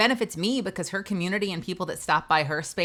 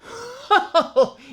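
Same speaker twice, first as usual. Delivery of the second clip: high laugh; 7 dB louder than usual. Real, not acted. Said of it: "laughing before the voice".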